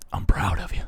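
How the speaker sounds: whispering close to the mic; right in your ear. Real, not acted. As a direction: whisper it into the microphone. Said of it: "I'm gonna whisper into the microphone now".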